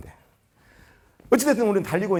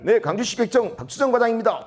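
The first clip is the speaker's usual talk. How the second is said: high, brisk on-duty phone greeting; 5 semitones higher than usual. Acted. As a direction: answer the hotline like an eager official. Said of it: "다 이렇게 전화 받아요". (힘차고 높은 전화 응대 목소리)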